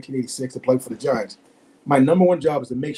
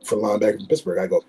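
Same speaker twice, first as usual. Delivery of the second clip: ordinary talk, nothing marked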